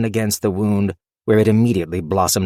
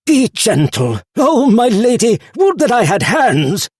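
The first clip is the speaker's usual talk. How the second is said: high, loud pleading sword voice; anguished cry; 13 semitones higher, 6 dB louder than usual. Acted. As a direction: cry out for her, high and desperate. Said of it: "cried Nigel".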